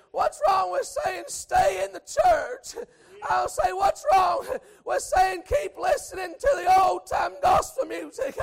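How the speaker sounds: loud, high impassioned pleading; full-force preaching. Acted.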